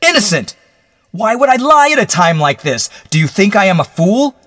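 high, loud desperate outburst; anguished shout, pitch way up; bursting forth. Acted. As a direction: explode into a frantic plea. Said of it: "Then he burst forth".

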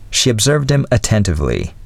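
ordinary delivery

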